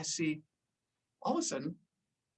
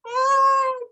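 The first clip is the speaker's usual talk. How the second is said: much higher, louder helpless cry; panicked cry; 6 dB louder than usual. Retold or imitated. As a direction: yelp as he's dragged upward. Friend pulled to surface.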